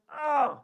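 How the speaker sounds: high, loud exclaimed "oh"; uncomfortable yelp. Acted. Cat stroked a third time, high loud yelp. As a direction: cry out at the unwelcome touch.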